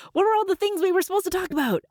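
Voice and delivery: high, rushed panic; flustered. Pretend. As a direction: scramble like you've lost your notes. Flustered host forgetting topics.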